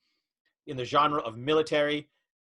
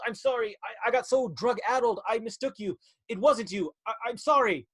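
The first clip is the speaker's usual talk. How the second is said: higher, hammed-up begging man; groveling plea; 6 semitones higher than usual. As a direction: grovel for mercy. Villain begs forgiveness.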